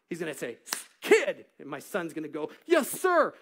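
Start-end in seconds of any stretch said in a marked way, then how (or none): 1.0-1.5 put-on sharp loud bark, Kid; officer's bark. Officer barking. 2.7-3.3 high loud snap-to answer; startled recruit. Retold or imitated. Son answering officer.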